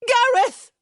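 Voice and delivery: high, loud shriek of a name. A narrator's reading. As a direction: scream for him in wild joy. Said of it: "she shrieked".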